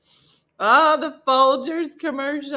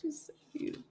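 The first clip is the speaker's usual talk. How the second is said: breathy hushed whisper, nearly silent; moved whisper; 4 semitones higher, 16 dB quieter, much faster than usual. Real, not acted. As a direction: whisper it as if overwhelmed. Moved by The Notebook.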